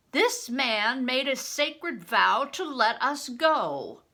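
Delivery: loud, slow public declaration; said loudly. A narrator's reading. Crispin loudly calling out the steward's vow before the armed men. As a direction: proclaim it loudly so all the men hear. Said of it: "I said loudly".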